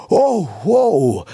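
amazed cry. A narrator's reading. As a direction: call out in awe. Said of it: "rief Topper". (staunend ausgerufen)

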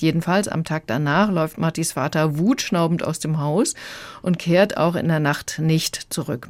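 ordinary talk, nothing marked

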